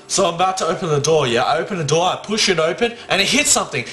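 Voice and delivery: ordinary talk, nothing marked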